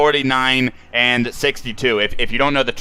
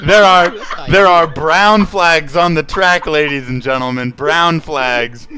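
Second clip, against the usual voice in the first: laughing through the words; 5 semitones higher, 9 dB louder, slower than usual. Real, not acted. Laughing announcing brown flags.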